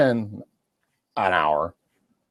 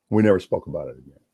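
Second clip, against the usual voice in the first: normal talking voice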